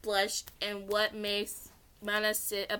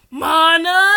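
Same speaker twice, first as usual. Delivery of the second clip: very loud shouted name; angry shout; 7 semitones higher, 16 dB louder than usual. Acted. Man calls Mana angrily on the retake, loud shout. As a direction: bellow the name from afar. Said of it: "A deep voice shouted".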